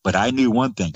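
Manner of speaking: usual speaking voice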